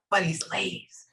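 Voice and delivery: a secretive unvoiced whisper. Shown as a demonstration. Medium whispering the spirit's words to the sitter. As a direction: whisper it under your breath. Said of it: "that quiet".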